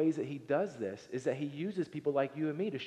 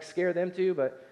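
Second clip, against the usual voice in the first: ordinary delivery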